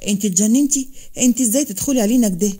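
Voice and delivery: usual speaking voice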